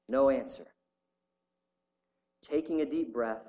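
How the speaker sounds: reading a story aloud with feeling. Acted. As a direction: read it like a gripping testimony. Reads a story aloud in the sermon.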